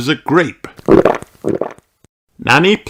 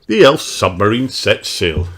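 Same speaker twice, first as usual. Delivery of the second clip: usual speaking voice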